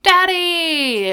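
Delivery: high, loud mock squeal for Dad; imitated delighted scream. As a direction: squeal it out like a reunited daughter. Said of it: "she starts screaming".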